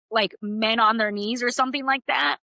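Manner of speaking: ordinary delivery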